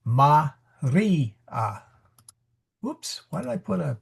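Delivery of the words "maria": "Maria" with the middle "ri" stressed and long; stress on second syllable. Shown as a demonstration. Teacher showing students the Latin stress, ma-REE-a. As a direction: lean on "ri" in "Maria". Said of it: "that's where it gets accented".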